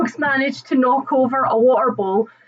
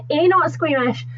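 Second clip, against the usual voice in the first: ordinary talk, nothing marked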